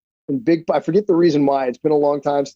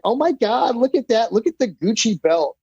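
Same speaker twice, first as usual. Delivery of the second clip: put-on starstruck kids; 7 semitones higher than usual. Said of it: "kids are like".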